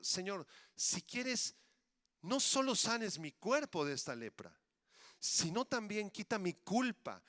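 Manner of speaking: impassioned, insistent prayer; fervent plea. Acted. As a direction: beg the Lord, punching each petition. Leper's fervent prayer acted out.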